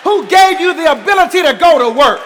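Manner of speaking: full-force shouted preaching; rising sermon shout. Acted. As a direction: preach it out to the congregation.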